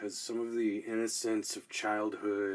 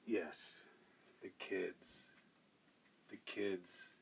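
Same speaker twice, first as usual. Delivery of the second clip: soft; 3 semitones lower, 8 dB quieter, slower than usual. Real, not acted. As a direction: drop to a soft tender voice. Drops to soft answer.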